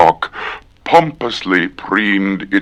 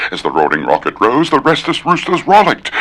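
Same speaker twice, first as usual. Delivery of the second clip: fast; 6 semitones higher, faster than usual. Shown as a demonstration. Repeats twister at speed.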